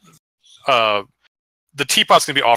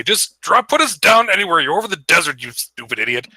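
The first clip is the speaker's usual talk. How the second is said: raised, higher-pitched irritated giant; bickering; 5 semitones higher than usual. Acted. Game master voices giant arguing over the desert, bickering. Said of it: "you start to hear bickering".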